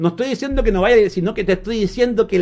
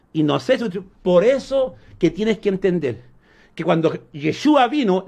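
ordinary talk

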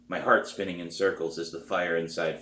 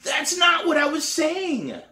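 much higher, louder, slow frustrated cry; imagined scream; 5 dB louder, slower than usual. Retold or imitated. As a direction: yell it out in frustration. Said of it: "start screaming".

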